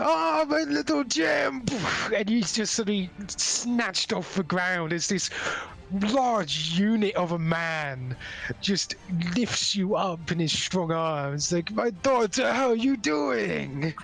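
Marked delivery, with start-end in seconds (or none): 0.0-1.6 high, drawn-out joyful cry; overjoyed papa calling out in a high voice; doting father. Acted. Father swoops up his long-missed daughter, overjoyed cry. 11.7-13.9 high, stretched-out gushing greeting; doting father. Acted. Father hugs his returning daughter, gushing and high.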